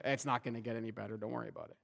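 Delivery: low, quiet and dismissive; a deflating, offhand tone to a friend in need; flat discouraging brush-off. Pretend. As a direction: shrug it off flatly as hopeless. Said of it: "We would never say".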